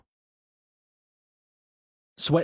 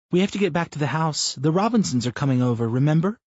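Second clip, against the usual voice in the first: Brenda's voice; 7 semitones higher, 4 dB louder, faster than usual. Acted. Wife teasing her husband mid-run, higher voiced. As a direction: narrator lifts into a higher, lightly voiced wife.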